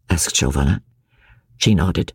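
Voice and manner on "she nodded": plain reading voice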